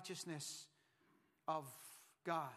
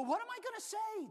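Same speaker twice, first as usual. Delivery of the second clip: put-on New York accent; 12 semitones higher, 3 dB louder than usual. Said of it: "He probably said it with a New York accent".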